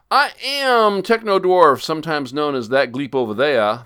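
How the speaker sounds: hammed-up podcast opener; host intro. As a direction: announce yourself like a herald.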